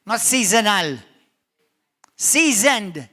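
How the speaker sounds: Seasoned punched loud and slow; preacher shouting Seasoned; stress on seasoned. Shown as a demonstration. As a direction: hammer Seasoned against seasonal. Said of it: "Jesus is looking for seasoned".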